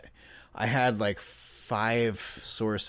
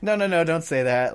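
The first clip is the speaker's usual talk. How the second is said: higher, louder "No, no, no" in a rush; retold hasty correction; 6 semitones higher, 4 dB louder than usual. Dad urgently tells his daughter not to say it, higher and louder. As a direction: stop the child before she repeats it. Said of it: "And I was like".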